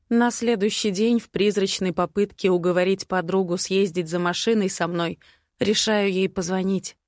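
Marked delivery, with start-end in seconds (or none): none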